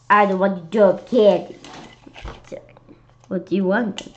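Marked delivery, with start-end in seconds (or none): none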